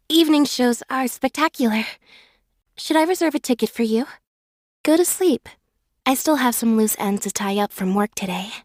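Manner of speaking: ordinary delivery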